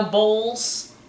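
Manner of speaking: ordinary talk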